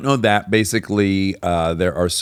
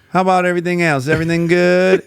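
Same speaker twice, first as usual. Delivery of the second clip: high Southern drawl, endlessly sociable; 9 semitones higher, 4 dB louder than usual. Retold or imitated. Richard keeping a phone stranger talking, Southern drawl. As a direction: imitate a Southerner stretching out small talk. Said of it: "He's from the South".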